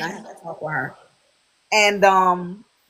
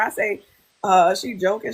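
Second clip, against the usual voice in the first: usual speaking voice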